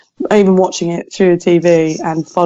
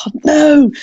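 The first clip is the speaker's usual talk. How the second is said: mock dismay; 9 semitones higher, 5 dB louder than usual. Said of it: "And I was like".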